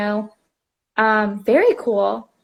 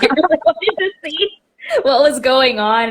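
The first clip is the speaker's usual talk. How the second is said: put-on higher, laughing through the boyfriend's confession; laughing boyfriend quote; 5 semitones higher, 4 dB louder than usual. Teller quoting her curious boyfriend, laughing. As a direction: giggle out his disappointed curiosity.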